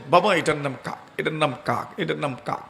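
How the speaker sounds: soft, patient, fading tender repetition; father patiently answering his little son; loving and gentle. Shown as a demonstration. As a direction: tell the child again with affection. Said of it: "অত্যন্ত মহব্বতের সাথেই কিন্তু বলবেন।".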